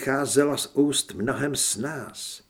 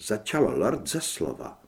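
ordinary delivery, nothing marked

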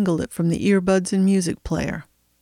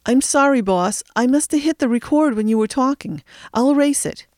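scared apology; 6 semitones higher than usual. Acted. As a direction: scramble to apologise to the boss. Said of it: "Sly looked scared".

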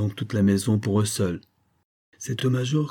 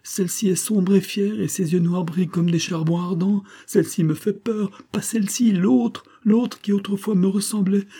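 very high-pitched, agitated delirious woman; frightened raving; 12 semitones higher, 4 dB louder than usual. Acted. Delirious patient demands the other lady, frightened raving. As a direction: plead in fevered alarm, voice held high throughout.

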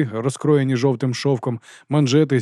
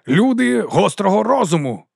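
high, slow, drawn-out proclamation; ringing oration; 8 semitones higher, 4 dB louder, slower than usual. A narrator's reading. Captain presents his officer to troops, grand ringing oratory. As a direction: stretch each word for the crowd. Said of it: "Його голос дзвенів".

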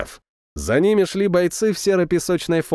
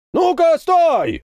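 very high, drawn-out angry yell; 14 semitones higher, 3 dB louder, much slower than usual. A narrator's reading. Captain orders a tank to stop, yelling. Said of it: "заорал он".

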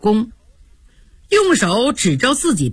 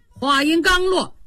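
ordinary delivery

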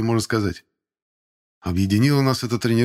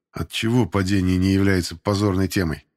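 plain reading voice

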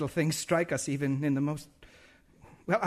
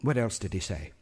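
sorrowful; 7 semitones lower, 5 dB quieter than usual. Acted. Minister grieving for Dick.

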